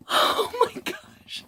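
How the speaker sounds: high, breathless gasp of shock; stunned excitement. Real, not acted. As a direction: gasp the words out slowly, overwhelmed. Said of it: "I'm so freaking ex".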